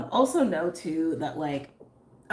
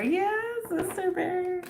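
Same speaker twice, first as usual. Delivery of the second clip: high drawn-out greeting; slower than usual. Real, not acted. Greeting a card spirit.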